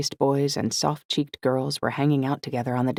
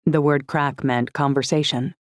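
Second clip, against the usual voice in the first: ordinary delivery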